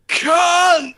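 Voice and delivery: very high, very loud yell; shouted insult. Retold or imitated. Lad yelling at the narrator as the doors open, very high and loud. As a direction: bellow it across the car park.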